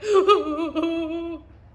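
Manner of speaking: high, loud mock boo-hoo; loud echoed sobbing. Voice from across the pond answers the beaver, loud mock sobbing. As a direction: echo the beaver's bawling. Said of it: "said the voice from across the pond".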